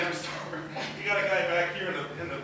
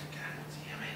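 muttered curse; 12 dB quieter, slower than usual. Retold or imitated. Frustrated in restroom.

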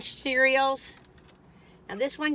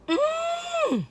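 a sudden high squeal of pleasure; high delighted mm. Real, not acted. Reviewer tasting a cookie, high delighted mm. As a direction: squeal mm at the first bite.

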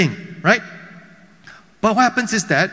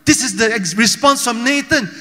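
ordinary talk, nothing marked

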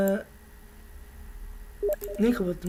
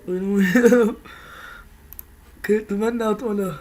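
usual speaking voice

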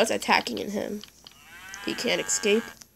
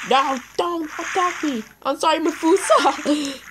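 higher, louder begging; frantic plea; 7 semitones higher, 5 dB louder than usual. Real, not acted. Begging Mafusa not to attack, frantic plea. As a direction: plead with the animal.

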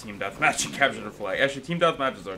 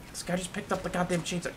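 mock whiny complaint; 8 semitones higher, 4 dB quieter than usual.

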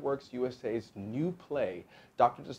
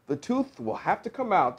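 higher-pitched, matter-of-fact pronouncement; announced verdict; 9 semitones higher, 7 dB louder than usual. A narrator's reading. Dentist giving the fox his verdict, announcing. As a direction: announce it clearly with raised pitch. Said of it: "Doctor DeSoto announced".

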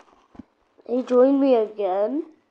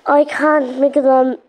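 loud insistence; 7 dB louder than usual. Real, not acted. Shouting at other player.

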